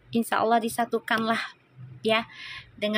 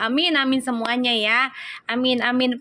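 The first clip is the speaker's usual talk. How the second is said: usual speaking voice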